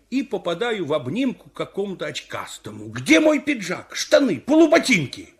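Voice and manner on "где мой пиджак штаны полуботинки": high, loud indignant demand; outraged yell; shouting. Acted. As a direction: shout it at the man grabbing you. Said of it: "кричу ему".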